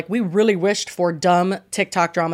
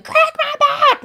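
mock yell; slower than usual. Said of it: "she's yelling".

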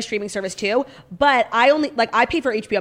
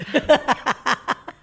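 embarrassed laugh; 5 semitones higher than usual. Real, not acted. Laughs after spitting on herself.

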